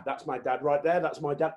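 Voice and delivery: ordinary delivery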